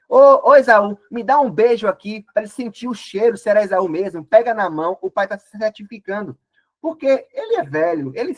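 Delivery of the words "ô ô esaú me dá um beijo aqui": loud, higher call to his son; old father calling out. Acted. Blind Isaac calls his son close to kiss him, loud and higher. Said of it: "então ele chega assim".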